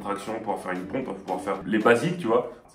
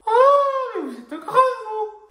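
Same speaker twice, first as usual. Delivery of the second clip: squeaky, very high mock granny voice; imitated startled granny; 6 dB louder, slower than usual. Granny startled by him at the bakery, high squeal. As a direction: squeal it like a shocked little old lady. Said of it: "T'as une mamie, elle me fait".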